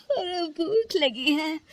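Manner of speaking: high, slow, whining; just-woken complaint, drawn out; hungry late riser. Acted. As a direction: drag out a hungry whine.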